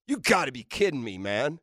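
normal talking voice

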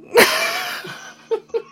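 high helpless giggle; corpsing giggle. Real, not acted. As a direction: burst into laughter. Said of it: "commonly known as corpsing".

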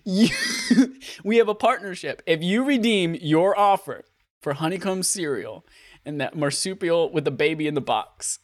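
breaking up laughing mid-pitch; high, giggling ad read. Real, not acted. Laughing through fake ad.

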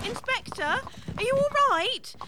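alarmed calling. Acted. Companion calls to inspector fallen from carriage, alarmed. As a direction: call after someone who has fallen.

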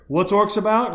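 ordinary talk, nothing marked

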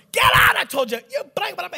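hammed-up angry cursing; cursing old man. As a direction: rant like a furious patient, swears blanked out. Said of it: "He's cursing me out".

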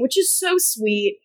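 ordinary talk

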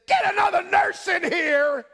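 a patient fed up with missed needles, shouting; demanding shout. Retold or imitated. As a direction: yell for a replacement nurse. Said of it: "a normal person would have said".

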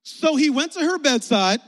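big, measured unison-reading lead; leading group reading. Real, not acted. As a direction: project it slowly so a congregation can join. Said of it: "We're gonna read the Bible together".

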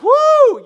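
high, loud "woo!"; fired-up whoop. Retold or imitated. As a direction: let out an excited high whoop. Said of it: "I get all fired up".

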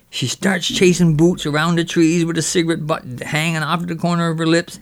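normal talking voice

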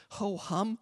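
soft, idle ho-hum; quietly uninterested; dilly-dallying child. Retold or imitated. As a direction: say it idly, in no hurry. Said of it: "they just were dilly-dallying".

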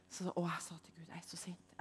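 whispered confession. Retold or imitated. Woman confessing anger to God in a whisper. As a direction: breathe it out secretly.

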